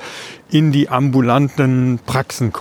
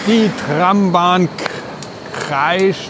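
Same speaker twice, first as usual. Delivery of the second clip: atmospheric narration; 4 semitones higher than usual. Acted. Poetic scene-setting intro.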